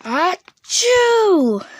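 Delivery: loud, high, sudden sneeze; exploding storybook achoo; big sneeze. A narrator's reading. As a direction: blast out a huge bear sneeze. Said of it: "makes the bear go".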